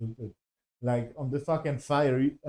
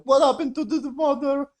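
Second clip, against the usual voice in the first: Galadriel's question; 10 semitones higher than usual. Retold or imitated.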